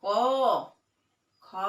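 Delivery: "क, ख" with the अ drawn out; inherent vowel shown. Shown as a demonstration. Teacher showing children the अ inside क, letters drawn out. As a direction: sound out "क, ख", letting the final अ ring. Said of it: "क में अ आ जाता है".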